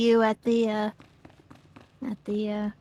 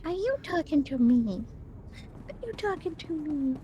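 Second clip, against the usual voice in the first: higher, soft talk-to-the-dog voice; cooing it to a little dog; 6 semitones higher, 5 dB quieter than usual. Retold or imitated. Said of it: "talk to your little dog voice".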